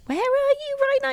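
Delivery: anxious friend on the phone; high worried voice. Retold or imitated. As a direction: ask it high and fretful. Mimicking her own worried phone call.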